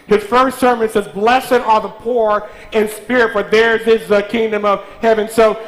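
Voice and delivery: loud, high preaching over the church; full-force preaching. Acted. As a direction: drive the blessing home, full voice.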